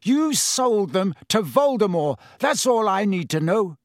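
high, loud accusation; furious. A narrator's reading. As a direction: spit the blame at him. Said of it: "a furious pounding in his ears".

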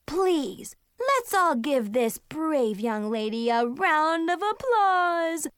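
high, stretched-out ringmaster patter; milk the big reveal for applause; showy magician announcer. Acted. Little sister presents her volunteer to the audience, grand showman.

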